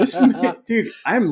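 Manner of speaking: tipsy viewer cracking up; laughing disbelief. Retold or imitated. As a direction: laugh through the words. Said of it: "And I'm like".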